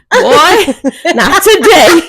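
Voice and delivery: exasperated parent, played for laughs; retold mock scolding. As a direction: tell off a toddler while amused. Retold scolding of her son.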